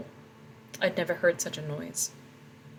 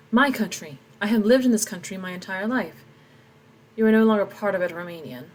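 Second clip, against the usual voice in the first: louder, pleading defiance; indignant protest; 2 semitones higher, 5 dB louder than usual. Acted. Tomas insisting to the soldiers, loud and indignant. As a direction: stand your ground, voice raised.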